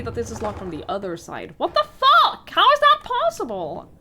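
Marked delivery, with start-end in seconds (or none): 1.6-3.9 loud, high shocked yell; shocked outburst. Real, not acted. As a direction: blurt it out in loud disbelief. Door locked from other side.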